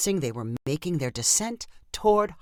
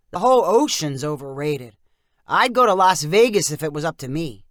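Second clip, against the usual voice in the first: dismissive uncle; 7 dB louder than usual. Acted. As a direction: shrug it off like a grumpy uncle. Wyatt's uncle voiced.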